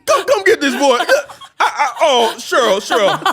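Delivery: high, loud frantic calling of Cheryl; laughing, hammed-up panicked husband; dad calling mom. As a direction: yell for your wife in mock panic. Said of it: "he's like".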